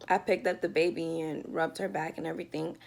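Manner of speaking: usual speaking voice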